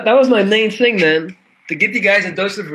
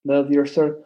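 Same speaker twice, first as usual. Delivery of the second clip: normal talking voice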